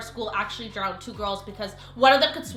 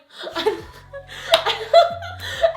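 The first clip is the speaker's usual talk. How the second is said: high laughing fit; 5 dB louder than usual. Real, not acted. Laughing at own memory.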